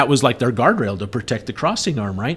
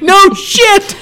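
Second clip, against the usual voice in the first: high, loud yell breaking into a laugh; panicked yell; 7 dB louder than usual. Retold or imitated. Narrator yelling back at Doogie to move the truck, loud high shout. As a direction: shout the order over the engine noise. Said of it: "And I'm like".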